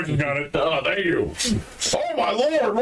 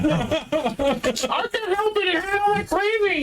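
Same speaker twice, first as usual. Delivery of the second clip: put-on whiny kid voice; 13 semitones higher, slower than usual. Whiny reply to scolding.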